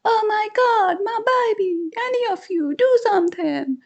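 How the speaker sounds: put-on very high panicked scream for her baby; yelled out. Mother yelling for someone to save her baby, panicked. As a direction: cry out in terror for the child. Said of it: "yelled out".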